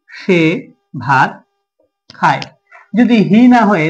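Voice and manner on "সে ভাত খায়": slow, long pauses between words; word-by-word dictation. Shown as a demonstration. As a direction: dictate it one word at a time. Dictating translation word by word while writing. (থেমে থেমে, একটা একটা শব্দ করে বলা)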